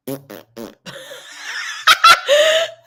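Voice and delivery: high shrieking burst of laughter; loud shrieking laugh. Real, not acted. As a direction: crack up hard and loud.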